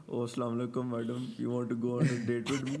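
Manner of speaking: mock flirty Pakistani uncle, fast; pakistani accent. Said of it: "and I be like".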